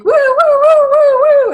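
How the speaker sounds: high wailing woo-woo of a police siren; siren imitation. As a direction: mimic a siren going by, loud and high.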